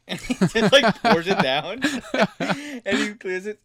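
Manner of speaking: high, loud, cracking up while talking; real laughing. Real, not acted. Engineer telling how the star drank coffee, laughing hard. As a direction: push the story out through laughter.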